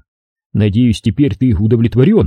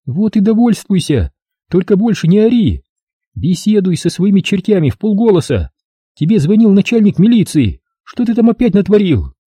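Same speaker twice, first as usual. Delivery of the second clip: higher scolding wife's voice; 9 semitones higher than usual. Acted. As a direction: nag the drunk husband sharply. Wife keeps scolding.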